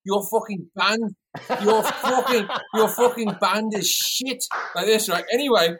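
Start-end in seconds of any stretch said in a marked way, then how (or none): 0.0-4.4 mock Noel insult. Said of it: "He goes".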